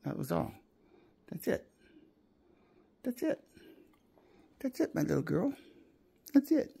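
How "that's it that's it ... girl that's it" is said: talking to a dog. Real, not acted. Praising the dog.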